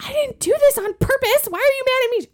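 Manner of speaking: put-on high, whiny teen protest; upset teen. Teen daughter pleads with her angry mom, high and whiny. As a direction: squeal it like a hurt kid.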